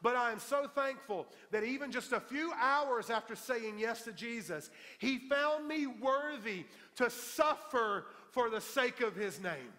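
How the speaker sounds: high, loud retold testimony, slowing for weight; impassioned quote. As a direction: proclaim the friend's gratitude at full preaching force. Quotes beaten friend's grateful words.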